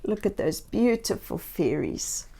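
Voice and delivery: plain reading voice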